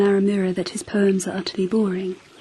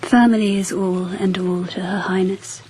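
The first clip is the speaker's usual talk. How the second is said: ordinary delivery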